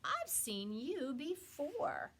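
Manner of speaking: high, slow bird voice; Maisie voice. Acted. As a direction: draw it out, sly and surprised. Maisie recognizes Horton.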